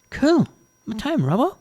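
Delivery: put-on high, keen young woman; eager young Jane. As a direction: jump at the chance, pitched high. Jane answers the manager.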